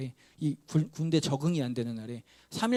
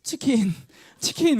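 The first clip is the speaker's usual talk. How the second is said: high, louder, trembling squeak; trembling tiny voice; 8 semitones higher, 5 dB louder, slower than usual. Retold or imitated. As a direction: quaver out the password in terror. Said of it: "벌벌 떨면서 막 지렁이 길어가는 목소리로".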